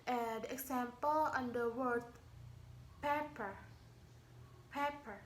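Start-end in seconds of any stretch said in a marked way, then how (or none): 3.0-3.6 "pepper" with the first syllable stressed, falling after. Shown as a demonstration. Teacher showing noun stress to learners, "pepper" stressed on its first syllable. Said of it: "stress is on the first syllable". 4.7-5.2 "pepper" with the first syllable stressed, falling after. Shown as a demonstration. Teacher showing noun stress to learners, "pepper" stressed on its first syllable. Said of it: "stress is on the first syllable".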